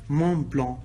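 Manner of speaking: "مون بلون" with a soft French nasal ending, no K; French pronunciation. Shown as a demonstration. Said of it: "زي الفرنسيين". (نطق "مون بلون" زي الفرنسيين)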